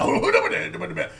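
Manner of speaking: loud, high mock bellow easing off at the end; mocking shouted imitation; exaggerated Japanese impression. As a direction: blurt it out as an overblown caricature. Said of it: "that's how he does it".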